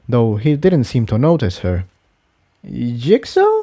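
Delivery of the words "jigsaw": hesitant question. Acted. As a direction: call his name gently, not sure he'll answer. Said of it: "asked hesitantly".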